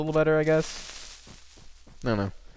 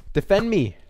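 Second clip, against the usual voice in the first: shouted plea; 8 semitones higher, 4 dB louder than usual. Real, not acted. Calls golem against creeper.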